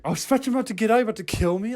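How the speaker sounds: high-pitched mock outrage; acted argument. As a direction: imitate an indignant passenger in a squabble. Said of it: "the little argument that they had".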